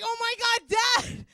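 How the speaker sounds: high, loud mock outcry; appalled shout. Daughter appalled at dad hanging up, high shout. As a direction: cry out in hammed-up disbelief. Acted horror at dad's refusal.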